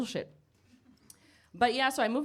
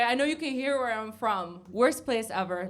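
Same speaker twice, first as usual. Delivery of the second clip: ordinary delivery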